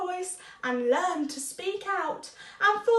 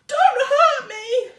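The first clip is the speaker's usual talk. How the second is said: high, louder, slow pleading lion; frightened whimper; 9 semitones higher, 11 dB louder, slower than usual. Acted. Lion whimpers to the mouse, high and frightened. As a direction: beg not to be hurt. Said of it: "he whimpered".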